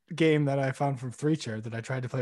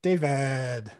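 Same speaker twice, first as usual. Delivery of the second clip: Schitt's Creek impression. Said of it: "a good Moira one".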